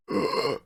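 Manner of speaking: loud, sharp mock gasp; big imitated intake of breath. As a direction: act out a shocked gasp. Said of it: "he just went like".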